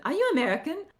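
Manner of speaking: high, rising question; imitated curious question. Locals asking about the speaker's twang, high questioning imitation. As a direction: lift it up high like a stranger asking. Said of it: "instead of going".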